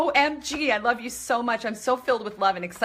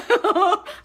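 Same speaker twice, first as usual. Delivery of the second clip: delighted high laugh; 9 semitones higher, 4 dB louder than usual. Real, not acted. Fans sending love on stream.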